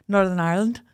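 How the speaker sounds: Northern Irish accent, overly proper; too-posh Northern Irish. Shown as a demonstration. Co-host trying the local name, posh Northern Irish. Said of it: "No, that was too posh".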